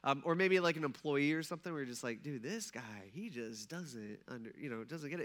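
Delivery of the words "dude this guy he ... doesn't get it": low, quiet mock exasperation; weary frustration. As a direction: sink into acted, slowed disbelief. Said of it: "It's super frustrating".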